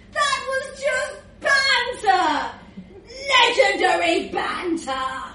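high, loud, drawn-out defence; boisterous protest. Acted. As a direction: shout it off as harmless fun. Rudolph loudly defends his antics.

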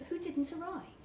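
plain reading voice

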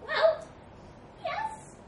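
high squeaky mouse, pausing between words; slow, hesitant. A narrator's reading. Mouse reluctantly agreeing with the elephant, slowly. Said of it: "said the mouse slowly".

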